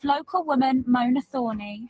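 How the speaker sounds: usual speaking voice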